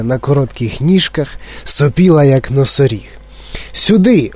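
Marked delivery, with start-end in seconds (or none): 3.7-4.3 higher called shout; calling out. A narrator's reading. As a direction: call the group this way. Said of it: "гукнув містер Вонка". (гучний поклик, вище)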